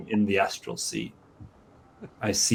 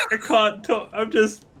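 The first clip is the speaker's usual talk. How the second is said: crying voice; 12 semitones higher than usual. Acted. Player acts out tear-stained crying.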